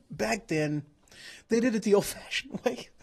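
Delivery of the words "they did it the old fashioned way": cracking up mid-sentence; laughing. Real, not acted. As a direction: laugh through the last words. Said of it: "I couldn't even get that one out without laughing".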